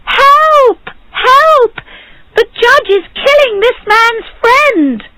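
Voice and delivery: very high, loud cries for help; crowd crying out. A narrator's reading. Crowd outside the judge's door, crying out for help. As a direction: yell the alarm at the top of your range. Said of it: "began to cry".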